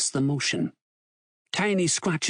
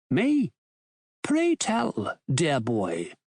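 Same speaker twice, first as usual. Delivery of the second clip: posh voice; slower than usual. A narrator's reading. Ructions feigns innocence to Tiny on the phone, posh voice. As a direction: put on a posh English gentleman. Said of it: "Ruction says in a posh voice".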